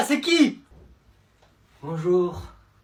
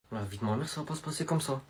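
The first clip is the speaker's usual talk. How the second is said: muttered threat; 8 semitones lower, 17 dB quieter, faster than usual. Acted.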